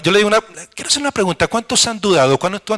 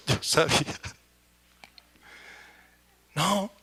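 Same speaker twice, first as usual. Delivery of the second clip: much softer, deflated; quietly admitting defeat; resigned mutter; 12 dB quieter, slower than usual. Retold or imitated. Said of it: "Y yo también".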